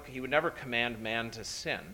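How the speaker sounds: ordinary delivery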